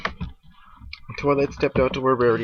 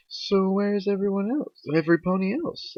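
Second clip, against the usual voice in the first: Twilight's questioning voice; 10 semitones higher than usual. Acted. Twilight asking after her missing friends, high questioning voice.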